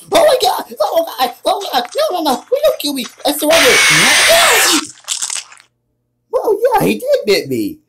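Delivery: panicked high shrieks, begging for life; terrified screaming. Real, not acted. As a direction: scream in real terror as it grabs you. Jump scare, caught by monster.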